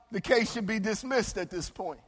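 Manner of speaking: ordinary talk, nothing marked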